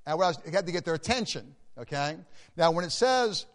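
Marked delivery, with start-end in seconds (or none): none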